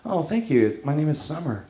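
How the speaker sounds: soft, quiet imitation of a woman; lightly voiced, demure reply; wife introducing herself. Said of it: "She said".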